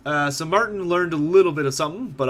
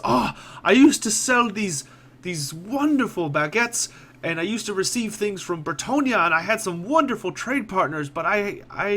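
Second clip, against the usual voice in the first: lost in memories of better trade; wistful merchant; 6 semitones higher than usual. Acted. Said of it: "his eyes seem to glaze over".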